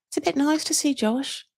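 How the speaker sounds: put-on English accent with dry understatement. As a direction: imitate an English parent's accent, politely understated. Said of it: "obviously, A, love accents".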